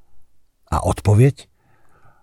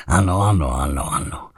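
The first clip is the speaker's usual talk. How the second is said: knowing chuckle; 4 semitones lower, 5 dB quieter than usual. Acted. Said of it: "Tlusté rty se letmu usmály".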